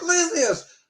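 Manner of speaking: high, exasperated mock yell; high indignant outburst; retold yelling. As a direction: yell it in outraged disbelief, pitch way up. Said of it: "I'm just yelling at my guys".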